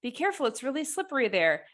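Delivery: mock heads-up, fast and lifted; retold warning. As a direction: imitate a hurried warning. Retold warning to people near water.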